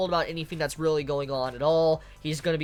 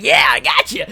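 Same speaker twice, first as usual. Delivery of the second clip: a loud, teasing "gotcha" in the author's voice; louder, playing the author who just tricked his readers; smug author voice; 4 semitones lower, 9 dB louder than usual. The author teasing his readers, a loud mock "gotcha". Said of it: "So he's like".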